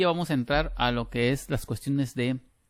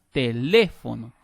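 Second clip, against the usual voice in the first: "Teléfono" slowly, LÉ punched louder; stress on LÉ; 6 dB louder, slower than usual. Shown as a demonstration. Teacher illustrating the pitch curve to students, stressed LÉ in "Teléfono". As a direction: hit LÉ hard in Teléfono, then fall away. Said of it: "Por eso yo le hice esa curvita".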